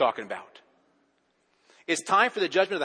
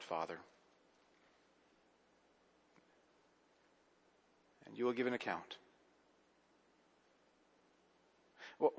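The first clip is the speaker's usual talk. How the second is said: hushed sermon reflection; 3 semitones lower, 7 dB quieter than usual. Acted. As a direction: draw them in quietly, almost intimate. Quiet reflective sermon passage.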